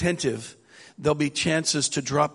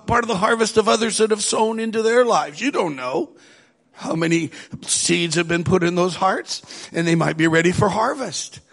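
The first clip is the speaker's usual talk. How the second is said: higher, louder preaching; 6 semitones higher, 3 dB louder than usual. Acted. Pastor urging the congregation, full preaching voice. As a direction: drive the harvest message home.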